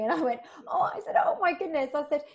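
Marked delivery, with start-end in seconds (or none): 0.7-0.9 surprised oh. Retold or imitated. Startled as he picks up. 1.2-1.9 higher, louder oh my goodness; surprised gasp. Retold or imitated. Startled saleswoman on the phone, surprised exclaim.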